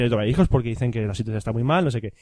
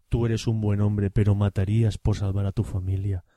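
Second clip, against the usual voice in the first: much lower, slow moralizing voice; voz grave de sermón; 8 semitones lower, 3 dB quieter than usual. Retold or imitated. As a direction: preach the moral in a deep put-on voice. (voz grave y lenta de sermón moralizante)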